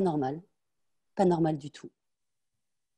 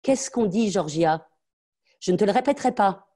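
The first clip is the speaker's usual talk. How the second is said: higher, louder, each word a threat; furious mother; 4 semitones higher, 6 dB louder than usual. Acted. Mother demanding her daughter's thanks, higher and louder in fury. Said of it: "la mère ... parce qu'elle est furieuse".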